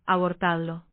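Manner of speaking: low, understated indifference; indifferent. A narrator's reading. Isen answers after a long silence, with mild indifference. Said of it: "dijo con indiferencia".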